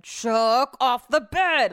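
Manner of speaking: mean snap. Retold or imitated. Husband snapping at the cat to get off the bed, loud and mean. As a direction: bark it at the dog like a mean owner. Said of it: "Brett snapped at him ... like, super mean".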